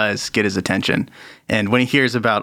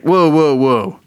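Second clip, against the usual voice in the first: louder whoa, hand up; stopping them; 4 semitones higher, 5 dB louder, much slower than usual. Acted. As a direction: halt them, loud then settling. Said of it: "he's like".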